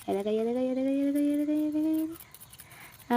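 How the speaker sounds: high, soft cooing, slowing at the end. Real, not acted. Girl coos to the cat during play, soft and high. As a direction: coax the cat softly and sweetly.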